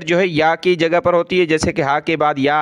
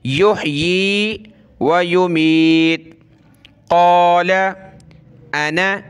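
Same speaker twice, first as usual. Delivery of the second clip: Quran recitation; much slower than usual. Shown as a demonstration. Teacher recites the verse slowly for pupils. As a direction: intone it slowly like tilawat.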